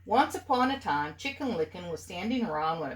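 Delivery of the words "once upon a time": usual speaking voice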